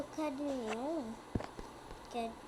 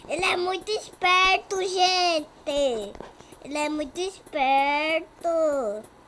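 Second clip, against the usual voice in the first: loud exclaim; 4 semitones higher, 9 dB louder, slower than usual. Real, not acted. Exclama alto.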